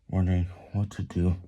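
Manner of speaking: plain reading voice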